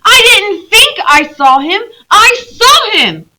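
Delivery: high, loud, near tears; tearful insistence. Acted. Lucy insisting she saw Aslan, high, loud and tearful. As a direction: plead hard to be believed. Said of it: "stamping her foot".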